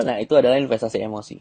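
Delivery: normal talking voice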